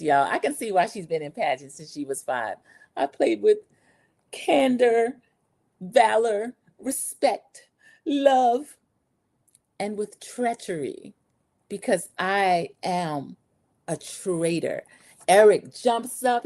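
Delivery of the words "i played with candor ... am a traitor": lightly voiced, lifted pageant reveal, slowing to the end; pageant Candace. Recapper mimics contestant's dramatic traitor reveal, mildly hammed-up. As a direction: savour each word like a crowned queen, lightly. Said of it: "This was pageant Candace".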